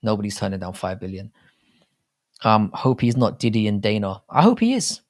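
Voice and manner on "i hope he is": jumps way higher into a squeaky put-on voice; high, squeaky mock answer to himself; mock high squeal. Mocking reply to own hope.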